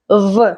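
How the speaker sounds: buzzed "vuh" for V; V as a sound. Shown as a demonstration. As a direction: say V as in van, not its name. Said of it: "like in van".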